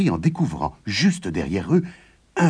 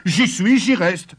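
louder, high-pitched stubborn retort; defiant; 11 semitones higher, 4 dB louder, slower than usual. A narrator's reading. Farmer refuses to leave the giant's land, defiant. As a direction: dig your heels in, voice raised. Farmer stands his ground, quarrelling.

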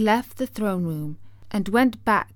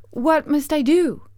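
put-on young girl asking; 7 semitones higher, 7 dB louder than usual. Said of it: "asked the girl".

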